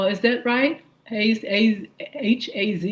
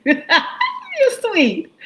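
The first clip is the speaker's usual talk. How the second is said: high giggle over the words; laughing; 14 semitones higher, 3 dB louder than usual. Real, not acted. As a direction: laugh it out, touched by the compliment. Laughs at viewer's compliment.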